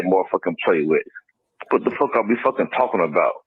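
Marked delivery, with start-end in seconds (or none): none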